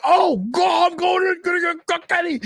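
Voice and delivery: panicked high yelling; frantic high shout. Retold or imitated. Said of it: "I'm like".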